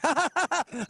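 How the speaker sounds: high, fake polite laughter; forced mock laugh. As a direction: fake an awkward laugh along with the crowd. Imitates his laugh along with crowd.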